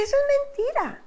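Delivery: high-pitched retold protest; indignant disbelief. Wife telling husband the program is a lie, indignant and high. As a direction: reject it incredulously, voice jumping high.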